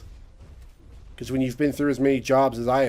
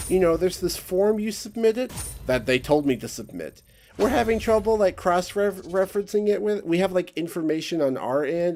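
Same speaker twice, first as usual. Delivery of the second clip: much higher, prissy bureaucrat voice, hammed up; mock agency lady; 6 semitones higher than usual. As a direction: parody a clueless clerk reading excuses. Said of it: "And they're like".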